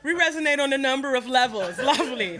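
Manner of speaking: high, loud mock-polite delight; laughing approval. Imitating herself bonding with the crowd, loud laughing approval. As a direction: gush it through laughter. Acts pleased response to crowd's agreement.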